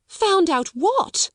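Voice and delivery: high, slow uneasy demand; startled. Acted. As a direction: press her nervously. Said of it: "she exclaimed".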